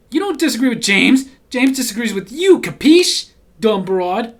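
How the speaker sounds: a hint of a wiseguy threatening; tough guy. Reading his brother's mob-style comment, a loud mock tough guy.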